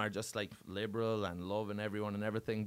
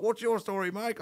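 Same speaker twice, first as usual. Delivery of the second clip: high-pitched challenge in retelling; hammed-up suspicious questioning; mock confrontational demand; 10 semitones higher, 3 dB louder than usual. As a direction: turn on the next man accusingly. Colin turns on the teller.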